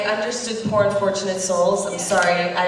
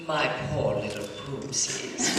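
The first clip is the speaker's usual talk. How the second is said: Ursula line; 6 semitones lower, 5 dB quieter than usual. Retold or imitated. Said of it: "one of my favorite lines is".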